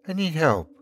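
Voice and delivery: put-on high little-girl plea; strangled, soft child. A narrator's reading. Little girl begs a stranger for help, in a small voice. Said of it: "The strangled, soft voice".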